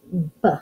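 B said as its short sound, "buh"; letter sound. Shown as a demonstration. Teacher sounding out box for kids, B as "buh". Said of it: "start with the first sound".

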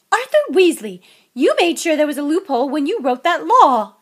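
loud, high scolding yell; enraged wife at full volume; furious shout. A narrator's reading. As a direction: bellow the full name and accusation. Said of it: "shouted Missus Weasley".

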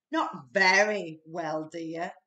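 slow, low words through a yawn; a worn-out father yawning. A narrator's reading. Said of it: "yawned Mister Bear".

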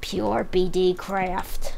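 slow, raspy smoker croak. Acted. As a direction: drag it out like a chain smoker. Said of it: "You sound like a raspy smoker".

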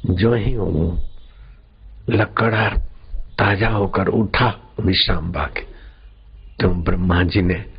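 normal talking voice

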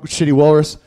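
ordinary talk

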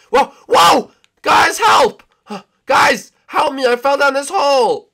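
high, loud panicked cries; yelling for help. Acted. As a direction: scream down from the hole. Fell down hole, calling for help.

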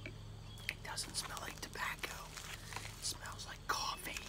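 hushed whisper, almost no voice. Real, not acted. Whispering to viewers while sniffing the tobacco. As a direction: breathe the words out in a whisper.

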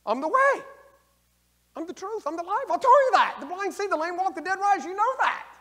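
very high, loud proclaiming voice; Jesus answering the grieving sisters, high and loud; Christ proclaiming. Acted. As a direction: declare each claim up high and ringing. Preacher voices Christ declaring himself.

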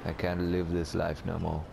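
hammed-up tragic farewell to life; mock despair. Melodramatic despair at stolen house.

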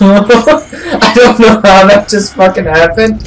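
laughing disbelief. Real, not acted. Laughing in disbelief after escape.

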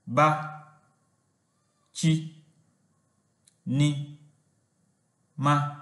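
"ba", "chi", "ni", "ma" each said apart with pauses; syllable by syllable. Shown as a demonstration. Teacher splits bachinama into syllables, slow and separate. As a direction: sound out each syllable alone. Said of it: "They are".

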